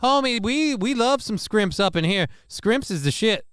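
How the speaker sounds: high, excited homie voice; hyped street voice. Pretend. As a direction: hype it up like a homie. Mock voice hyped about shrimp.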